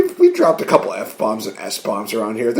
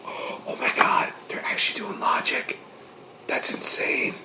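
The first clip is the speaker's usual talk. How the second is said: mock-shocked whisper; 12 dB quieter than usual. Podcaster marvels that wrestling bosses use logic, mock-shocked whisper. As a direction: whisper it as if it were an unbelievable revelation.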